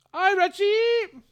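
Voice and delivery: slow, high, drawn-out hello; long squeaky-high doting hello; high-pitched greeting. Real, not acted. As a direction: sing out a loud, high greeting. Greeting someone called Reggie.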